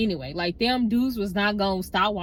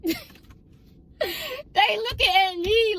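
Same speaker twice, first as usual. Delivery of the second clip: high, drawn-out mock wail; mock distress; 10 semitones higher, much slower than usual. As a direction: whine it out slowly, way up high. Mock reaction to being watched.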